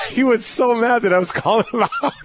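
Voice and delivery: high, laughing storytelling. Real, not acted. Player telling hosts of the mix-up, laughing.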